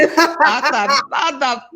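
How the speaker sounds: laughing through the words; real laugh breaking in. Real, not acted. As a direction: chuckle as you speak. Laughing while telling.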